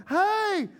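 high calling-out voice; friends yelling out. Acted. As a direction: holler after the departing couple. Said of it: "they're yelling out".

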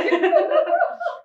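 a high burst of laughter. Real, not acted. Remembering her diet excuse, laughing high. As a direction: lets out a squealing laugh.

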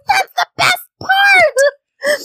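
very high, stretched-out squeal; hammed-up gleeful shriek, drawn out; imitating excited listeners. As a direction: mimic fans squealing with delight. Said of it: "And they're like".

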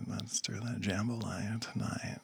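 whispered run-through of the line; practising under breath. Real, not acted. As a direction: whisper it to yourself, rehearsing. Rehearsing the line under his breath.

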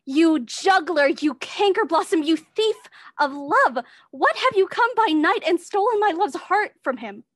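loud, outraged accusation; furious. Acted. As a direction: hurl the insults. Hermia rails at Helena.

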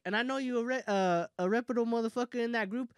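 usual speaking voice

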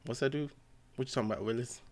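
ordinary talk, nothing marked

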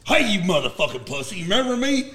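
mock confrontation, climbing high at the end; taunting challenge. J C in a kid's face, taunting challenge. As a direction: call him out, voice leaping on the question.

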